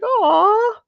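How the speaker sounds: high, loud sympathetic aww; touched aww. Retold or imitated. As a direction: melt at what she just said. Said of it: "I was like".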